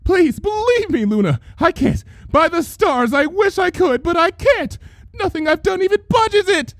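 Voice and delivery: high, trembling plea; shaking with terror. A narrator's reading. Trixie begging Luna to believe her, terrified. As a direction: beg her through terror. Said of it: "Her voice shook with terror".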